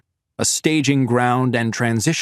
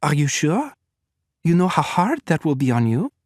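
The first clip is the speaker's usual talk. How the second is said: Victoria; 5 semitones higher than usual. A narrator's reading. Victoria cautioning Natalia.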